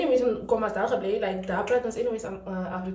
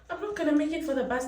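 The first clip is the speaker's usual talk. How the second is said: higher, panicked repeat; 7 semitones higher, faster than usual. Real, not acted. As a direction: wail it in a rush of panic.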